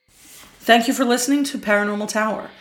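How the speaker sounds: bright podcast promo voice; podcast outro read. Acted. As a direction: sell the plugs like a host signing off.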